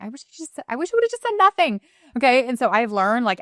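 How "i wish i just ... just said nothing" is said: regretful outburst. Retold or imitated. Coach lying awake after the game, mock anguished higher cry of regret. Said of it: "I'm like".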